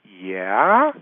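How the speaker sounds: "Yeah" starting low and rising slowly into a question; puzzled query. Acted. Confused woman answering unknown caller, questioning. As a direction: draw "Yeah" upward, unsure who's calling. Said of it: "she's got this space of saying".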